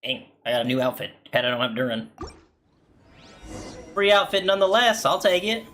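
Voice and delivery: ordinary talk, nothing marked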